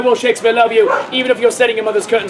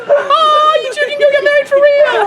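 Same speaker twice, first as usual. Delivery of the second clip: high squeaky mock voice; 14 semitones higher than usual. Teller mocks the crowd, high giddy squeal. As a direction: voice jumps way up, quick and giddy. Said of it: "and you're like".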